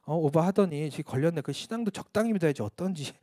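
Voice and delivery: half-voiced churchgoers sneering at him; a quiet, scoffing retelling of gossip; muttered gossip. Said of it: "수군거리기 시작했습니다".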